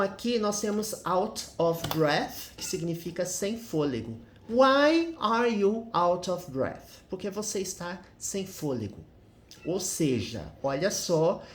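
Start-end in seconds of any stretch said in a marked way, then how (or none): 4.5-7.0 slow model question. Shown as a demonstration. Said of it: "model question read slowly".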